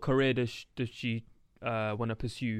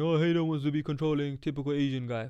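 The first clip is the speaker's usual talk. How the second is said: mocking commenter. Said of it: "I don't want people to start saying".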